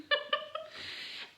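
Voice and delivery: a high bright giggle. Real, not acted. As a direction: giggle at your own impression. Laughs after mock squeal.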